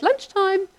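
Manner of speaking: high, lilting call to the sheep; high sing-song call. Retold or imitated. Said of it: "mum and I sound the same on the phone".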